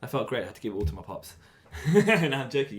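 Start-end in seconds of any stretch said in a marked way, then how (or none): 1.4-2.8 high, breaking into laughter; laughing retraction. Real, not acted. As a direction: laugh it off as a joke. Laughs off his own joke.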